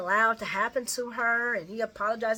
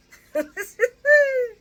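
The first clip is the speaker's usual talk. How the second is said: high laugh; 11 dB louder than usual. Real, not acted. Laughing at soap scene.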